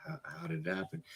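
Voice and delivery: whispered question to the friend who broke his phone; soft asking tone. Retold or imitated. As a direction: ask it gently, almost breathed. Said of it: "that was my tone of voice".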